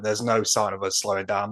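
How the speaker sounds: ordinary talk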